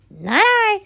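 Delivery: high, bored cat voice; a snooty cat who can't be bothered. A narrator's reading. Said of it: "said the cat".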